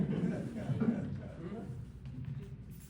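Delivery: soft, breathy laughing; laughter. Real, not acted. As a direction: laugh quietly after teasing a friend. Joking about friend at back.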